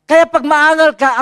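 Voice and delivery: high, loud exhortation to the congregation; rising preacher's cry; preaching shout. Real, not acted. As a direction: drive it home at full force. Preacher exhorting at full force.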